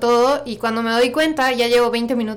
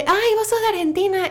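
high-pitched curious exclamation, mimicked; imitated surprise; 9 semitones higher than usual. People guessing the speaker is Argentine, imitated surprise. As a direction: mimic someone surprised, voice jumping up.

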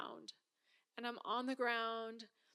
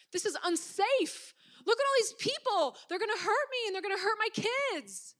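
fearful panic; 9 semitones higher, 7 dB louder than usual. Retold or imitated. Panicking on the crowded platform.

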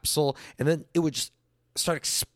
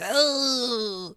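put-on whining kids; 5 semitones higher, much slower than usual. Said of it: "My brothers and I would be".

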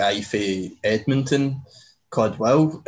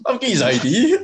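lightly voiced bouncer, higher and louder; front desk guy; 11 semitones higher, 5 dB louder than usual. Doorman telling Carter he has the ID, higher and louder. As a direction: pop it out like the surprised doorman. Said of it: "The guy goes".

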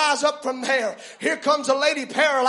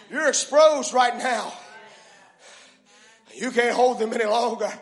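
ordinary talk

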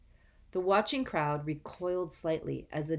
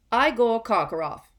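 curt and loud, pitched up; 7 semitones higher, 10 dB louder than usual. A narrator's reading. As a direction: call the name curtly. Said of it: "said a curt voice".

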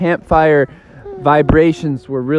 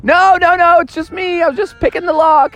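Froggy screaming; 13 semitones higher than usual. Acted. Froggy screaming across the campsite to his parents, high frantic. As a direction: yell it's only you, from far away. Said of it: "heard Froggy screaming from across the campsite".

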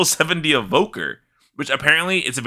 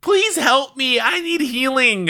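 high, whiny begging for healing; whiny pleading; 12 semitones higher, slower than usual. Retold or imitated. Townsfolk begging the healer for help, high whiny plea. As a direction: beg like a helpless townsperson.

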